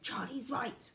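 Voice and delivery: ordinary delivery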